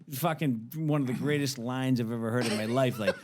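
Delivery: ordinary talk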